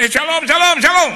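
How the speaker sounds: high, loud excited phone greeting; shouting. Retold or imitated. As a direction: shout an eager greeting down a bad line. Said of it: "Pak Pendeta nggak usah teriak".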